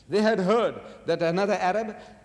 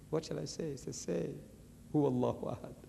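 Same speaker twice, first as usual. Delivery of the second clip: hushed retelling; 9 dB quieter than usual. Retold or imitated. Quiet retelling of revelation.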